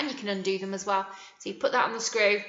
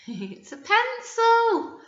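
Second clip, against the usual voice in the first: excited reveal; 11 semitones higher, 5 dB louder, slower than usual. Acted. Teacher revealing a picture.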